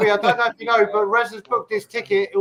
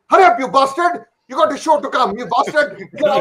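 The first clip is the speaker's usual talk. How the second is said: higher, louder hammed-up scolding; louder, higher mock scolding mother-in-law; mock nagging mother-in-law; 5 semitones higher, 5 dB louder than usual. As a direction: bark at him to hurry like a cranky mother-in-law. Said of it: "the conversation was more like".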